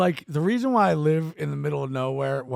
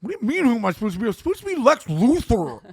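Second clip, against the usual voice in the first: offended retort; 7 semitones higher, 3 dB louder, faster than usual. Retold or imitated.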